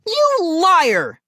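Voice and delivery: very high, loud accusing yell; screaming. A narrator's reading. Sister calling brother a liar, screaming. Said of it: "Molly screamed".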